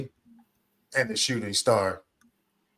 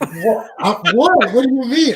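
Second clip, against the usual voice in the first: high, loud outraged what; shocked disbelief; 10 semitones higher, 8 dB louder than usual. Real, not acted. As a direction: burst out in mock outrage. Shocked at threat to leave.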